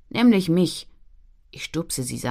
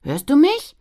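calling out; 8 semitones higher, 7 dB louder, slower than usual. Acted. Calling a friend who ignores her.

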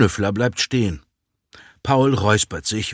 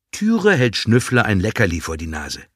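ordinary delivery

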